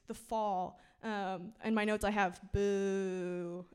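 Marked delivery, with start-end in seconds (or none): 2.5-3.7 long drawn-out playful boo; mock booing. Speaker boos the fall from the notes, long mock jeer.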